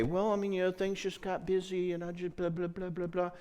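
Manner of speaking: high, meek, fading excuse mumble; high squeaky excuses, trailing off into blah; whiny Chicken Little. Retold or imitated. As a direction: cower and make excuses. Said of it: "not respond like Chicken Little".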